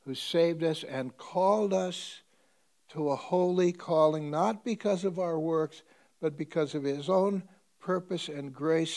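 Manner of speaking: preacher proclaiming scripture; scripture reading. Acted. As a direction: read it out like holy writ. Reads scripture aloud.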